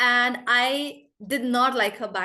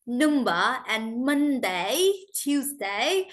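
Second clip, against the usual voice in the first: slow demo of a thick British accent; exaggerated old British accent, word by word; much slower than usual. Shown as a demonstration. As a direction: draw the words out in a strong British accent. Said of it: "I used to say it like".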